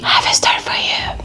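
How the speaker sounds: whispering. Real, not acted. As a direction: lean in and whisper the secret. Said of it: "Ndi kuvuga gake".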